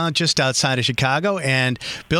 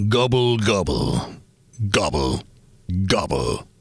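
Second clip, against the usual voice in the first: lower, slow "Gobble, gobble" like a turkey; 7 semitones lower, slower than usual. Shown as a demonstration. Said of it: "Here's the turkey voice".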